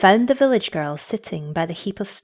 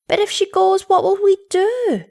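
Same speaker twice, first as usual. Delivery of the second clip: much higher, louder frightened plea; despairing cry; 13 semitones higher, 6 dB louder than usual. A narrator's reading. Children afraid their mother will leave, high despairing cry. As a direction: panic like scared children. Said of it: "they cried in despair".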